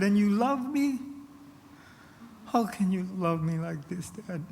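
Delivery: high, incredulous pleading; imitated tearful boy. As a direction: act a crying child begging his dad for an answer. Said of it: "started to tremble and to shake and cry".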